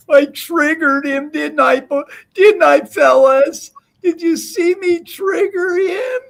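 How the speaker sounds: high, gleeful gloat to the fellas. Real, not acted. Gloating to chat after taunting rival.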